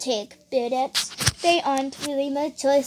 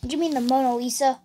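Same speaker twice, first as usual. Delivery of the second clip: ordinary delivery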